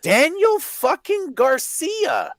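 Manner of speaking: very high, slow, drawn-out disbelief; stretched-out, high-pitched exasperation; incredulous outrage. Real, not acted. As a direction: wail the name as if it were absurd. Incredulous at who was beaten.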